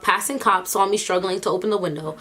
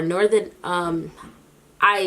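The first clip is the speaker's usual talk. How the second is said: normal talking voice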